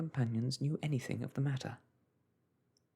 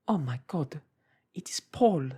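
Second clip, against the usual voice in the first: shocked cry; 3 semitones higher, 7 dB louder than usual. A narrator's reading. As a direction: gasp out the name in shock. Woman recognises her brother, shocked.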